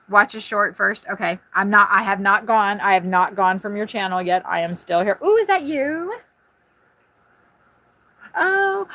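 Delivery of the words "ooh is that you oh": excited ooh. Real, not acted. Excited high squeal at a sighting.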